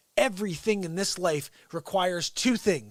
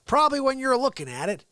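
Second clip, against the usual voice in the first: ordinary talk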